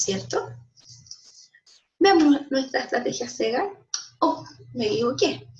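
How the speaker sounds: ordinary talk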